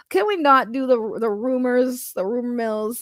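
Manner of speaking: ordinary delivery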